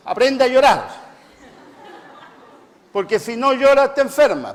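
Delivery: más agudo y fuerte, mandato; ordénalo con fuerza a los machos; exhortación alta. Real, not acted. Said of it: "exhorta a los machos". (exhortación fuerte y aguda)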